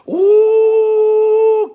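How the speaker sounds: high, loud drawn-out "Ooh"; delighted ooh. Real, not acted. Reacting to Candace Lewis's comment, a high delighted ooh. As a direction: let a thrilled ooh ring out.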